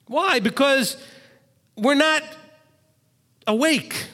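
high, emphatic; loud preaching. Real, not acted. Rabbi getting loud with his listeners. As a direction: hammer it home to the congregation. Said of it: "I get loud with you every week".

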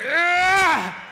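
high, far-carrying grunt of effort on the last push-up; straining yell. Pretend. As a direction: yell as if pushing up the last rep. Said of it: "I'm getting too excited".

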